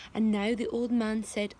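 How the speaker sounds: ordinary delivery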